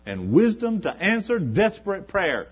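high, loud pulpit climax; rising sermon shout; preaching at full force. Acted. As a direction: drive it home to the congregation.

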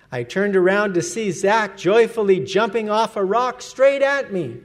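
normal talking voice

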